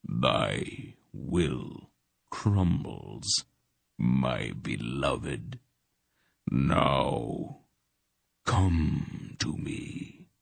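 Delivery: slow, soft low coaxing; soft purring god. A narrator's reading. Dark god luring Polgara, soft slow purr. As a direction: draw her in with quiet menace.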